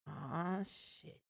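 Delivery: sinking realization muttered to oneself; hushed mock dread. Coworker seeing he had a bad night, hushed dread.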